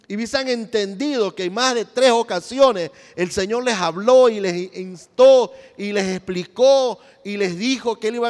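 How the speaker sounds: loud, pressing sermon build; fiery preaching. Acted. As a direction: drive the argument home to the congregation. (predicación enérgica y fuerte)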